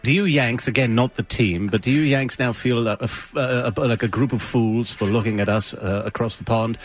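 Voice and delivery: put-on light British reporter accent; mock British press question, teasing; British reporter needling the Yanks, lightly put-on British accent. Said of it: "[name]'s British, uh, voice".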